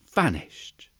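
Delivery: hushed storyteller whisper. A narrator's reading. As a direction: breathe the word out mysteriously. Storyteller's dramatic disappearance.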